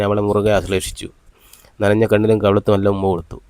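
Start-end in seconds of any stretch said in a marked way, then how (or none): none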